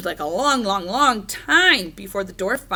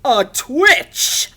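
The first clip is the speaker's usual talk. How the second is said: loud, high witch; weird witch voice; 4 semitones higher, 9 dB louder than usual. A narrator's reading. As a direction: deliver it as a hammed-up witch. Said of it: "the weird witch answered".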